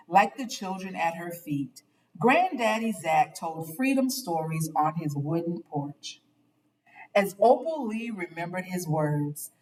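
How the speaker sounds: ordinary delivery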